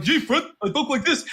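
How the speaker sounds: a hint of the cartoon buddy; Barney voice. Imitating Barney's first movie voice to a friend. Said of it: "And they're like".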